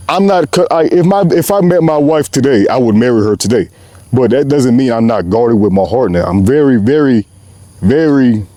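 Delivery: ordinary talk, nothing marked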